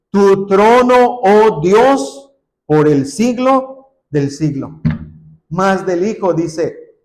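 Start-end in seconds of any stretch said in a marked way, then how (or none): 0.1-4.8 Tu trono, oh Dios called out loud and slow; loud proclamation. Acted. Preacher proclaiming the throne verse to the church, loud. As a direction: ring it out like a royal herald.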